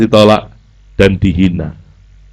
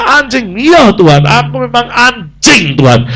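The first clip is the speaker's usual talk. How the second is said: much higher and slow, earnest; humble plea; 11 semitones higher, slower than usual. Acted. The woman answering Jesus, high and earnest. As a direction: cry out humbly. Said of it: "perempuan ini berkata".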